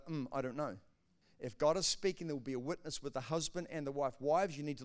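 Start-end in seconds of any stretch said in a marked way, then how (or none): 0.1-0.8 doubtful hmm. Retold or imitated. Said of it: "go with a".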